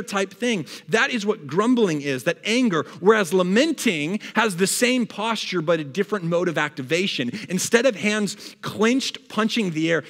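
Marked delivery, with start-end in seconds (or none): none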